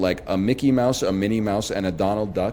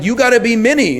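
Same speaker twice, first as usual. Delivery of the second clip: exasperated; 11 semitones higher, 7 dB louder than usual. Retold or imitated. Exasperated dad during pretend play.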